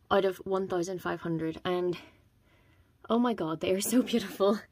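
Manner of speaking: breathless, quiet and airy; winded after talking, catching breath. Real, not acted. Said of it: "Sorry, I'm out of breath".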